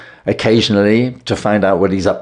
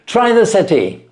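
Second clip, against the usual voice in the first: higher, brisk Holmes invitation; Holmes inviting; 13 semitones higher, 4 dB louder than usual. Acted. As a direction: wave the guest to the sofa, lightly voiced. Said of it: "said Holmes".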